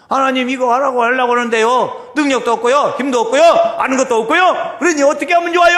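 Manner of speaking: high, shouted appeal to God; full-voiced acted plea; loud pleading. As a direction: cry out each lack, building to a desperate question.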